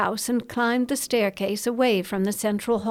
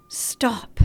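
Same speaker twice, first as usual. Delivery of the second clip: a sharp breathy whisper to herself. Acted. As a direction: whisper it as an inner command. Inner thought, whispered command.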